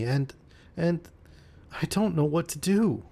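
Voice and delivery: a lightly acted girl about to cry; breaking into tears. A narrator's reading. Cynthia tells the narrator she's unwanted, close to tears. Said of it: "the poor child burst out crying".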